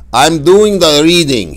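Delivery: high, loud affronted snap; a father stung at being corrected; indignant father. Retold or imitated. Barber father corrected by his son, loud indignant retort. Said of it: "he said".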